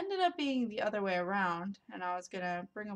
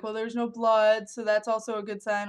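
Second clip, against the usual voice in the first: louder reassuring stepmom; 2 semitones higher, 7 dB louder than usual. Retold or imitated. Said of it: "She's like".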